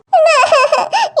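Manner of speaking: acted tearful whimper; crying whimper. Daughter whimpering while telling her mother, crying. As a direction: let out a sob.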